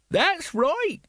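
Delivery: put-on very high, shrieky old woman's voice. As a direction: pipe up high from the crowd. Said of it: "said an old woman next to him".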